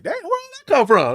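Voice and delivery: high, loud disbelief at himself; astonished. Retold or imitated. Actor amazed at his own emotional scene, astonished. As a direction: retell it as a loud, high-pitched amazed outburst.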